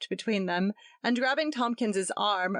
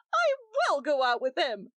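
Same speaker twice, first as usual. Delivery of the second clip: higher, defiant wild cry; mild wild defiance; 8 semitones higher than usual. A narrator's reading. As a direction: fling it back at him. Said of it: "cried Gretchen wildly".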